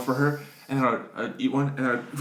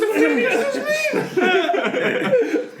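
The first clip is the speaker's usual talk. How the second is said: very high, slow, laughing disbelief; mock outrage; 4 dB louder, much slower than usual. Kid baffled by tax on candy, very high and slow. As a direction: squeal out exaggerated indignation.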